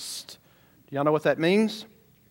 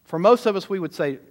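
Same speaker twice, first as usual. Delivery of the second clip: ordinary talk, nothing marked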